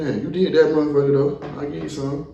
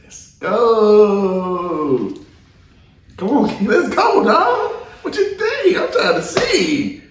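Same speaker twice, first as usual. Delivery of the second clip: high, drawn-out hype calls with laughter; hyped-up taunting; 9 semitones higher, 4 dB louder, much slower than usual. Real, not acted. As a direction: pile on the pressure, high and loud. Host hypes up player before answer.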